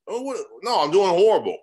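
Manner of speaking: high, loud, fed-up brush-off; acted irritated refusal on the phone; mock exasperation. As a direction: cut the caller off in mock annoyance. Imagined person rejecting cold call.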